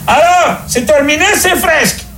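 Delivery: appel criard, très aigu; pape qui crie. Acted. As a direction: crier haut perché à travers une porte. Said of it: "il cria". (question criée par un pape impatient, voix très haute)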